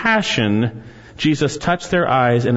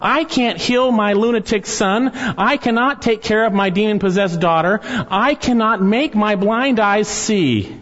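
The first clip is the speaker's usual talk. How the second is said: helpless pleading; 7 semitones higher than usual. Acted. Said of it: "were totally helpless".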